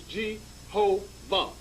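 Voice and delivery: Jehovah, slowly cut into three syllables: je-ho-vah; syllables spelled out. Shown as a demonstration. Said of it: "Three syllables".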